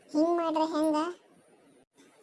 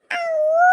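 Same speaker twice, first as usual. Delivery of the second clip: sudden high loud ghostly wail; ghost howl; 14 semitones higher, 8 dB louder, much slower than usual. Acted. Hiding in the dark to scare the women, high ghostly howl. Hidden prankster plays ghost.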